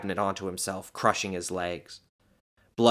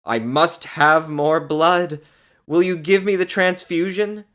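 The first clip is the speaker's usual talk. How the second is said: high, loud demand; angry pleading; 9 semitones higher, 9 dB louder than usual. A narrator's reading. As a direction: insist fiercely, half begging. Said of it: "she pleaded angrily".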